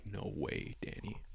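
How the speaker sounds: stunned hush on seeing the resealed box; heart sinking. Retold or imitated. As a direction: let it fall out in quiet disbelief. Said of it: "my heart sinks".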